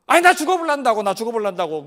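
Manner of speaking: put-on loud childish defiance; defiant tantrum. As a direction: throw a fit, dare God to kill him. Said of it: "배째라 선생".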